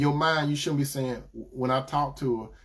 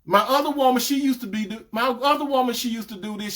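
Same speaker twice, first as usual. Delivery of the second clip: mock comparison; 7 semitones higher, 3 dB louder than usual. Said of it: "you can't say stuff like".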